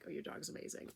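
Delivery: hushed whisper of admiration. Retold or imitated. Admiring a stranger's dog on a walk, whispered. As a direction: whisper it so the owner won't hear.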